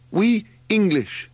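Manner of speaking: high, loud, drawn-out yell; simpering yell. A narrator's reading. Margot to the gesticulating drivers, yelling slowly and high. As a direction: yell it slowly, pitched right up. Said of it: "she yelled at the gesticulating drivers".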